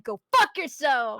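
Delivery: slow, drawn-out mock curse; mock angry comeback. Cashier imagines answering a rude customer, mock angry. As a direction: hit each word like an imagined telling-off.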